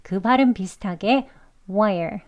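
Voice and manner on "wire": wire said short and light, like "wai"; short, weak wire. Shown as a demonstration. Teacher models a short, weak wire. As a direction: clip wire quick and weak. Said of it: "이렇게 좀 짧게 약하게 발음하시면".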